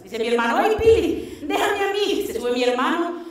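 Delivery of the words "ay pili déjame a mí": high, eager pleading voice; eager brother. Retold or imitated. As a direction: jump in keen to try. Brother asks to try.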